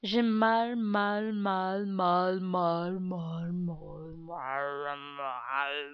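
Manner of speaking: drawn-out whiny pain moan. Acted. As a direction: moan it like a sore tooth. Repeated moaning of pain.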